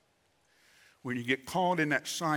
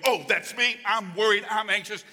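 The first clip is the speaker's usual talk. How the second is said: mock worry; 7 semitones higher, 5 dB louder than usual. Acts out anxious believers' reaction.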